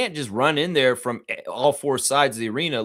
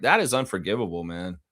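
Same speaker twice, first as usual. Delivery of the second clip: normal talking voice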